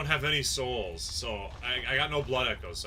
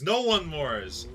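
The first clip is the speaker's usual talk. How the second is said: slowly drawn out with a rising end; "No one mores" drawn out slow, rising at the end; slow, mocking repeat; 4 dB louder, much slower than usual. Real, not acted. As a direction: savour the odd phrase "No one mores" slowly.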